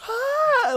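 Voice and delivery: short high shriek, mimicking; sudden loud imitation scream; imitated scream. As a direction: let out a quick high shriek. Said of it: "like, screaming at him".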